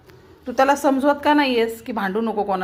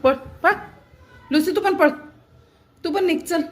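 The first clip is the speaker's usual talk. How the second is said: higher shooing call; shooing the cats; 6 semitones higher than usual. Real, not acted. Shooing Lucy off the table, higher voice. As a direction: chase the cat away, pitched up.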